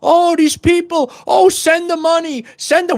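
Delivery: high mock televangelist plea, far from his own voice; a sneering parody of a TV evangelist; mocking TV preacher. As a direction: ham up a preacher begging for money. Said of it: "You go, you put on the T V".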